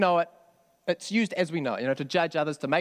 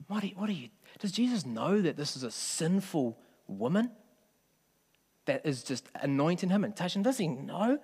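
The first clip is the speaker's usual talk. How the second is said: quietly scandalised mock questioning, pitch lifting; indignant attitude; 2 semitones higher, 4 dB quieter than usual. Preacher voicing Simon objecting to the sinful woman, indignant attitude. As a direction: imitate a disapproving, incredulous Pharisee, softly. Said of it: "picks up on Simon's attitude".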